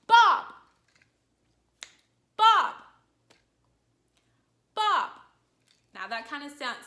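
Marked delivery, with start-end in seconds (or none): 0.1-5.1 loud, high, sharply falling call; exasperated shout at the dog; frustrated yell. Shown as a demonstration. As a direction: yell the name angrily with a steep drop. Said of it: "sounds like a yell".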